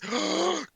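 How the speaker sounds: sharp acted gasp; shocked crowd gasp. Crowd watches the bite, gasping in shock. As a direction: gasp like a horrified onlooker. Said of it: "crowd goes shocked".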